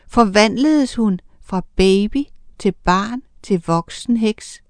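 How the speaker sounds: ordinary delivery, nothing marked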